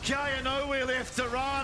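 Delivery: hyped live play-by-play call; esports caster. Acted. Caster calls the fight live.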